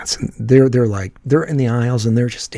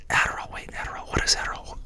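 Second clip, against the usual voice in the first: fast unvoiced whisper, much softer; whispered inner thought; 11 dB quieter, faster than usual. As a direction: whisper it to yourself, puzzled and quick. Narrator's inner thought quoted.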